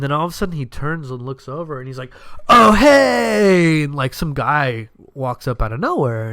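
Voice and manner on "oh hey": bright, high and loud "Oh, hey!" of recognition; surprised greeting. Retold or imitated. Buddy spotting an old coworker across the patio, surprised and loud. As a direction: call out as if spotting an old friend.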